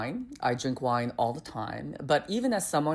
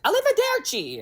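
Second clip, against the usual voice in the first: mock Italian; 3 dB louder than usual. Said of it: "It's like".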